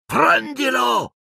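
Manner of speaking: high, slow, piercing command; dark lord orders servant, shrill screaming command; shrill shout. Acted. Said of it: "ma Voldemort gridò".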